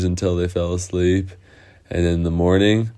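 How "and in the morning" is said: ordinary delivery, nothing marked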